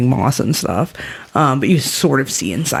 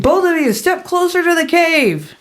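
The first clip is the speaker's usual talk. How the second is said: very high put-on woman's voice; woman calling out; 13 semitones higher than usual. Woman in the cave orders two strangers closer, high-pitched.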